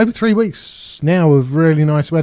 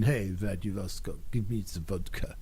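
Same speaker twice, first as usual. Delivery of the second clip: deliberately bad, lower Russian accent, much softer; crap Russian accent; 6 semitones lower, 12 dB quieter than usual. Vlogger jokes that he's a bit Russian, bad Russian accent. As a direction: ham up a cheesy Russian accent for laughs. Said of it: "that was a crap Russian accent".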